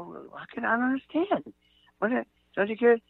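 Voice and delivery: shocked disbelief. Retold or imitated. Shocked at old man.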